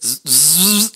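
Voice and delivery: buzzing power-tool zzz; impact wrench noise. Shown as a demonstration. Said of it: "Demande-moi de faire n'importe quel bruit".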